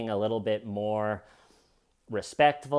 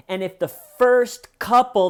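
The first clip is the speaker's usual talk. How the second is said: usual speaking voice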